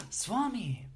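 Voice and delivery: imitated gasp, then high, joyful cry; super excited. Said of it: "they get super excited".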